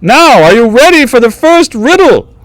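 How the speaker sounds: high-pitched court jester master of ceremonies; theatrical jester, far above normal pitch; jester announcing contest. Acted. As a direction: announce the rules in a piping jester voice.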